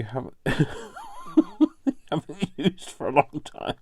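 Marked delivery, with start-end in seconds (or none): 0.4-3.8 laughing. Real, not acted. Laughing through the line.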